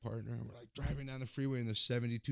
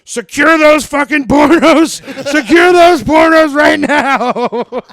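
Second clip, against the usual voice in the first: very loud, high, slow megaphone bark; bullhorn cop; 27 dB louder, slower than usual. Retold or imitated. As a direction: announce it through a bullhorn, laughing. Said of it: "get on his bullhorn and goes".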